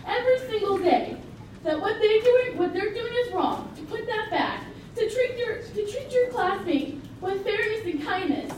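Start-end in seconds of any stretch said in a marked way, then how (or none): none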